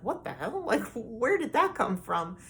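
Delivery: stunned and half laughing; retold shock. Retold shock at thrown food.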